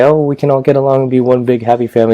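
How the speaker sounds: mock idealism. Mocking an idealized wish.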